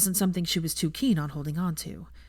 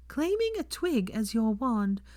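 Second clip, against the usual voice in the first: higher, drawn out and relishing it; slow gleeful tease; 5 semitones higher, slower than usual. A narrator's reading. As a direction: savour every word of the tease. Hermione teasing.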